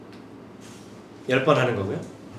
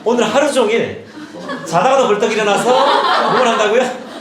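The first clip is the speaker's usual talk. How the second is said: high, loud call to the audience; raised, punchy rhetorical question; loud, emphatic quizzing; 5 semitones higher, 5 dB louder than usual. Real, not acted. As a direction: hammer it home as a rousing question. Rallying audience with a teasing question.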